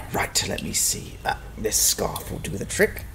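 soft whispered muttering to himself; no voice, just breath. Acted. Quietly improvising a torch in cave.

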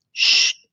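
zacht gesis; sst. A narrator's reading. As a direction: sis om stilte. Gitte vinger op mond. (Gitte sist opa stil)

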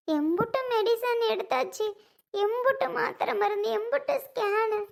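high-pitched, tearful wailing; அழுகை. Acted. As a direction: pour out the grief while crying. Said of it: "அழுகாத சுந்தரி". (அழுது புலம்பல்)

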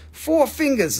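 amazed showing off the size. Acted. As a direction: pitch it up on four. Higher emphatic repeat.

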